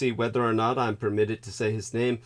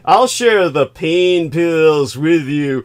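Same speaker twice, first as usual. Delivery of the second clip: mock creep; 5 semitones higher, 11 dB louder than usual. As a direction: drag out the sleazy offer mockingly. Mocking the guy's offer.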